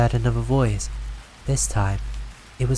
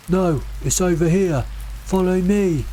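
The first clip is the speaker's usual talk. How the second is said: higher, raised insistent call; 7 semitones higher than usual. A narrator's reading. The brother's copied voice calling from another direction, higher and insistent. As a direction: call from the other side, insisting. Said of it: "my brother's voice joined in".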